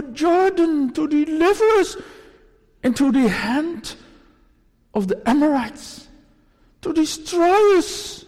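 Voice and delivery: a preacher's loud, anguished reading of Joshua's plea; loud lament. Acted. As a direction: cry out the prayer in despair. Preacher reads Joshua's despairing prayer.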